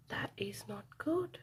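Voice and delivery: hushed gasp then whisper. Real, not acted. Reader whispers to children after the crash. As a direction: breathe it out shocked. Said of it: "narrator whispered aside".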